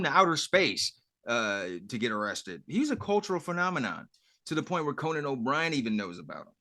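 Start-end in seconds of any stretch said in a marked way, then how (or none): none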